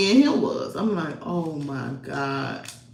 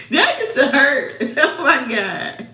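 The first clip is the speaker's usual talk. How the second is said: real laughter through the words; remembering dad's hard cheek pinches, laughing; 8 semitones higher, 5 dB louder than usual. Real, not acted.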